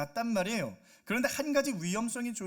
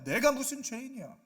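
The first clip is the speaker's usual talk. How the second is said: mock indignant comeback, raised pitch; angry retort; 5 semitones higher, 5 dB louder than usual. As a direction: act out an offended denial. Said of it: "화를 내요". (발끈해서 되받아치는 말투)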